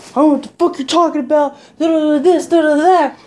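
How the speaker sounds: mock defensive rant, quick and high; imitated defensiveness. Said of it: "then he got real defensive".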